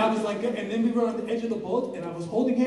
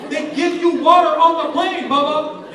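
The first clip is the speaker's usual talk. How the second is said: loud, higher exasperated plea; exasperated at dad; 5 semitones higher, 11 dB louder than usual. Retold or imitated. Son scolds dad about airport water, loud. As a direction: push back hard on dad. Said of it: "He always tries to bring water".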